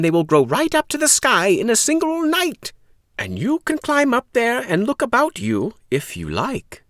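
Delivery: high, wondrous sales pitch; excited old man. Acted. Old man selling Jack the magic beans, high and wondrous. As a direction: make the magic sound wondrous.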